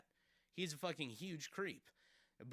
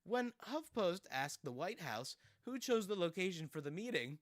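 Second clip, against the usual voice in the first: ordinary talk, nothing marked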